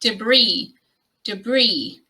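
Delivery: "debris" as duh-BREE; American "debris" with a schwa, then BREE; American pronunciation. Shown as a demonstration. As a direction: stress the second syllable of "debris". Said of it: "with the stress on the second syllable".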